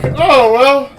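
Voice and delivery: high, loud put-on "oh"; feigned surprise. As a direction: act caught off guard, hammed up. Acts Drax feigning surprise at guests.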